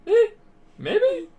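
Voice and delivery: very high, evasive little voice; noncommittal squeak. Acted. Mentor asked whether the boy saw ghosts, noncommittal squeak. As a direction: dodge the question in a comic high pitch.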